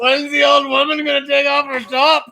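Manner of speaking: high-pitched mock creep; put-on high voice for a heckling perv; imitated Zoom creep. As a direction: call out as the lewd intruder, hammed up. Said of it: "He's like".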